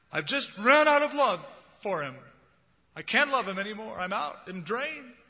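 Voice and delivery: high, loud weary lament; a hurt person claiming they ran out of love; drained complainer. Retold or imitated. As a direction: protest you have nothing left. Quoting drained people.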